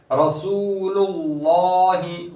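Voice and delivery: slow chant of रसूलुल्लाहि, first लाम thin and the लाम of Allah heavy; tajweed recitation. Shown as a demonstration. Teacher recites the word for students. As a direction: stretch the वाव and thicken the second lam. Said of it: "रसूल का ... लाम पूर होगा".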